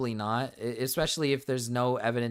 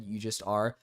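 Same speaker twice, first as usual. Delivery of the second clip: usual speaking voice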